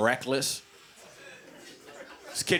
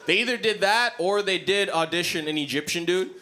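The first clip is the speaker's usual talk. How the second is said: ordinary delivery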